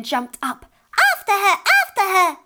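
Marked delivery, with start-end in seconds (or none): 1.1-2.4 high, loud alarm cry; shrieking. A narrator's reading. Fairy men rousing each other to chase thief, shrieking. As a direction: shriek it like a startled mob. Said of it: "they shrieked".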